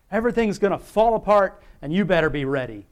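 hammed-up warning of collapse; mock alarm. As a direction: imitate an alarmist prepper video. Mimics alarmist prepper videos.

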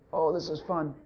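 very soft, deadpan irony; mock enjoyment. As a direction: toss it off drily as an unbelievable quote. Mocks the idea of enjoying sickness.

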